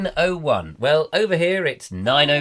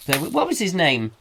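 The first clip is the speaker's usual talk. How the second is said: ordinary talk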